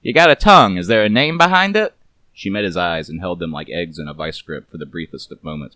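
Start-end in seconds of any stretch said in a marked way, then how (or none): 0.0-2.0 loud, fast wisecracking private eye; gruff detective voice. A narrator's reading. Narrator voices Garbo teasing the nervous woman, wry. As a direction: toss it off like a hard-boiled PI, louder.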